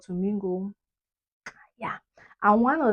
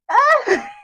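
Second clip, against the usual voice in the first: loud, high exasperated "ah"; mock cry of exasperation; 11 dB louder than usual. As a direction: cry out, half laughing. Mocking the fake British accent.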